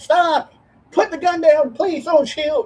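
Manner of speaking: high, panicked mall cop begging; Paul Blart pleading at gunpoint. Retold or imitated. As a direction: squeal it like a scared security guard. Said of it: "Not just like rented cop Paul Blart that ... goes".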